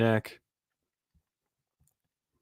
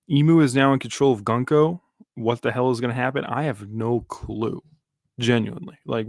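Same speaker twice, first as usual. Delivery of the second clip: normal talking voice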